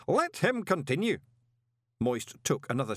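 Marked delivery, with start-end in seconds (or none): 0.1-1.2 high, solemn ritual intoning; booming intonation. A narrator's reading. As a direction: intone it grandly to an unseen assembly. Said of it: "the booming voice intoned".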